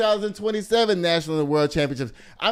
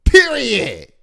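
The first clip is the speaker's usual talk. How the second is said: loud, high exclamation; emphatic call-out; 7 semitones higher, 9 dB louder than usual. Real, not acted. Host closes the point on Jimmy Fallon, emphatic call-out.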